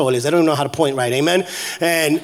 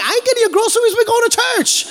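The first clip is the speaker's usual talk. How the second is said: high, exasperated retort; hammed-up high-pitched refusal; retold protest; 13 semitones higher than usual. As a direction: holler back in mock protest. Van driver arguing back at church ladies.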